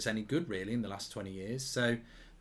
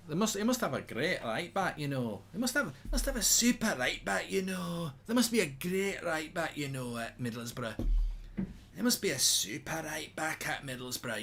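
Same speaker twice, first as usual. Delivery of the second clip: put-on pitched-up Scottish accent; 5 semitones higher than usual. Streamer mocks a pundit in a Scottish accent. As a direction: play a Scottish pundit praising the right back. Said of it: "that's a good Scottish accent".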